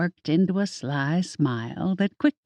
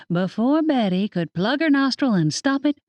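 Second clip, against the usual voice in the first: plain reading voice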